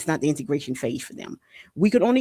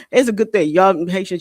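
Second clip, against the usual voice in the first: ordinary talk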